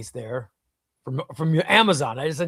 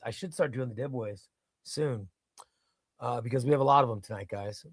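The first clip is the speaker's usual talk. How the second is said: usual speaking voice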